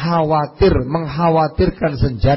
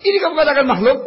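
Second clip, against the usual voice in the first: angry official; 11 semitones higher, much faster than usual. Retold or imitated. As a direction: challenge him like an angry official. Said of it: "Masa dia lagi marah". (pejabat marah, bertanya dengan nada tinggi)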